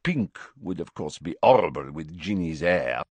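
put-on lilting French-accented young bride. A narrator's reading. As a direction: hold forth in a French accent about your own plans. Fleur, French character, chatting of wedding.

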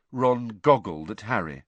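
ordinary delivery, nothing marked

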